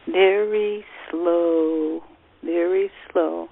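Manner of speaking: soothing breathing instructions; guided breathing meditation. Acted.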